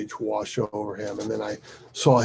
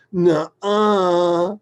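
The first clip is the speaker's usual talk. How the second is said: loud, higher, stretched-out mock defiance; resounding childish refusal; 7 semitones higher, 10 dB louder than usual. Stubborn daughter hitting back at her father, resounding. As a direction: imitate a child flatly contradicting. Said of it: "hits me back with a resounding".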